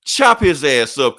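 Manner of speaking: slow, loud order; villain command. Retold or imitated. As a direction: order it coldly like a tyrant. Doom orders the chef killed.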